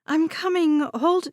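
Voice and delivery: high loud call through a door. A narrator's reading. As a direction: shout toward the door. Harper calling through door.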